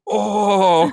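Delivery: long, high, loud imitated crowd "oooh"; crowd's "oooh". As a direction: mimic a whole room reacting with a drawn-out "oh". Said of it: "And everyone went".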